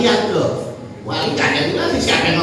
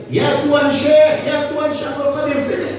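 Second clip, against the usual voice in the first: put-on slurred drunken shout for the teacher; drunk youth calling out; 7 semitones higher than usual. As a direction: call him over like a drunk young man.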